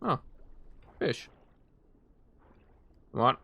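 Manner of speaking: ordinary delivery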